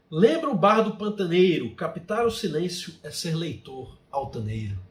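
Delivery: emphatic verse recital with a falling close; poetry recitation. Shown as a demonstration. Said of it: "E pra finalizar". (declamação poética de encerramento)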